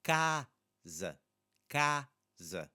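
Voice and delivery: casa split in two, open "CA", reduced "za"; casa broken up, first A wide, last A reduced; syllable by syllable. Shown as a demonstration. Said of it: "first A sounds very different than the second".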